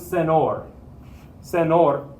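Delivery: "senor" with a plain n, as sen-OR; senor without tilde. Shown as a demonstration. Teacher warning students it becomes another word, plain-n mispronunciation. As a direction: drop the ny glide from "senor", just n. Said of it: "Doesn't sound like the word".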